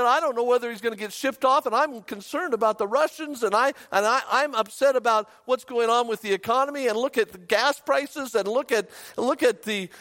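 high, breathless run-on worrying; retold panic. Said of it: "she was just panicked".

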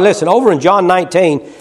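ordinary delivery

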